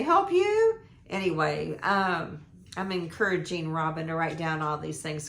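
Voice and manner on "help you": put-on very high, loud little girl's question; squeaky toddler echoing the grown-ups. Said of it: "So she would say".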